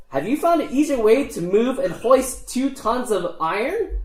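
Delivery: high, challenging gnome voice; indignant lead gnome. Acted. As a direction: challenge the dwarf as an affronted gnome.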